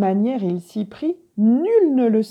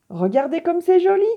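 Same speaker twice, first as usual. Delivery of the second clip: much higher, louder, quick joyful call; delighted cry; 9 semitones higher, 5 dB louder, faster than usual. A narrator's reading. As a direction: show off the new world with glee. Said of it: "s'écria Vieil Homme Coyote". (s'écrie, ravi et fort)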